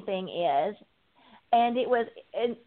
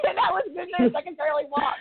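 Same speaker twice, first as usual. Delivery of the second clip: higher, quick words through real laughter; laughing; 8 semitones higher, faster than usual. Real, not acted. Guest joking to host about God's answer, laughing. Joking after her own punchline.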